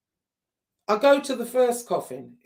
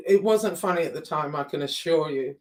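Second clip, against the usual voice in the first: normal talking voice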